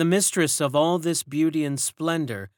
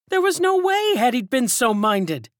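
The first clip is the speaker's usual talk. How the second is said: interrupting servant; 12 semitones higher, 6 dB louder than usual. A narrator's reading. Servant breaks in on the gossip, high and loud. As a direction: cut in sharply.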